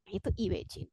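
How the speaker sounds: ordinary delivery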